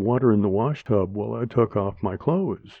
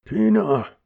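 sharp higher, louder call; scolding; 10 semitones higher, 4 dB louder than usual. Acted. Aunt scolds girl playing in the tub, sharp call. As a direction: scold her name. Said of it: "Aunt Linda scolded me".

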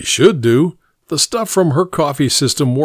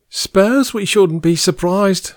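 aunt voice; 7 semitones higher, slower than usual. Acted.

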